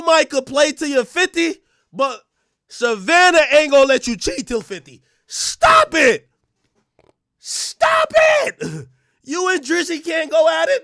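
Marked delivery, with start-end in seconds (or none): 5.3-10.8 squeaky high pleading stop it; high mock plea. Streamer begging LeBron to stop, high squeaky plea. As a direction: beg them to stop in a high put-on voice.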